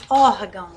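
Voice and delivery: "órgão" with a loud ÓR; stress on first syllable. Shown as a demonstration. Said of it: "qual que é a mais forte?".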